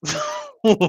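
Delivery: short high burst of laughter; real laugh. Real, not acted. Vlogger laughing at herself about joining Bad Boys Club in her thirties, bright laugh. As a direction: laugh out loud at yourself.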